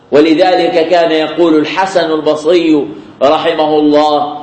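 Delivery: ordinary delivery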